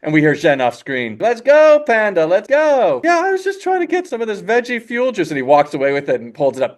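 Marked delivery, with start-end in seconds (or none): 1.2-3.0 high, loud, drawn-out call; calling impatiently. Acted. Zhen calling Po from off screen, impatient holler. 3.0-5.3 high-pitched put-on character voice. Po explaining himself to Zhen, high excusing voice.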